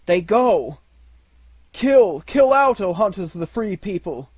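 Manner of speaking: high, loud rallying shout; wolf leader yelling to his hunters; battle cry. A narrator's reading. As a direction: rouse the pack to the kill. Phao urges the pack on.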